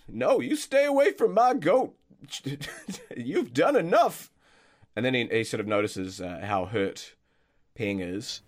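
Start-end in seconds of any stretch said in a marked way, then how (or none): 0.1-4.3 put-on much higher, louder, yelling old man; furious old groundskeeper. Groundskeeper yelling about his missing pipe, furious high shout. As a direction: rant like a cranky old keeper.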